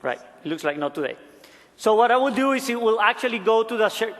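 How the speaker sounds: ordinary talk, nothing marked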